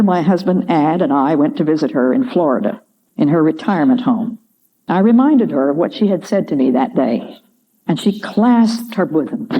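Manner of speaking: ordinary talk, nothing marked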